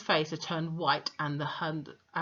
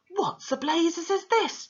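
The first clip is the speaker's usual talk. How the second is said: much higher, sharp yell in a put-on voice; 12 semitones higher, 3 dB louder than usual. A narrator's reading. Headmistress shaken by the chalk, angry yell. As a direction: bellow in shocked anger. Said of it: "yelled the Trunchbull".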